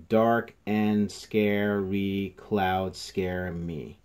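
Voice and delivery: "Dark and scary clouds scare me" very slow, each syllable held. Shown as a demonstration. Teacher counting seven syllables, "Dark and scary clouds scare me" syllable by syllable. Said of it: "see if it has seven syllables".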